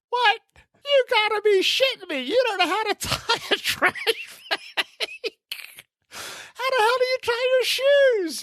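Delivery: very high, laughing mock outrage; hammed-up exasperation, cracking up midway; incredulous father. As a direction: squeal a dad's disbelief through laughter.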